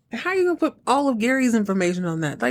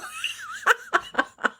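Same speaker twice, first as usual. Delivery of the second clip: helpless squealing laughter; real high laugh; 4 dB quieter than usual. Real, not acted. Recapper delighted by Earl's comeback, real laughter. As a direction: crack up for real.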